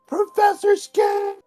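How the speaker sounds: long, high drawn-out yell; high slow calling yell. Pretend. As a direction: call out across the snow. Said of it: "gonna yell".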